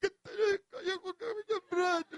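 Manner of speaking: retelling a tearful plea, voice breaking high; crying plea. Retold or imitated. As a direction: reenact begging a friend through tears. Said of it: "Paiyak-iyak pa po ako".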